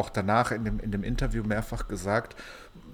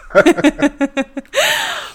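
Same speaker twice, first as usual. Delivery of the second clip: big burst of real laughter; loud laugh; 13 dB louder than usual. Real, not acted. Lacht über eigenen Fehler. (lautes Lachen)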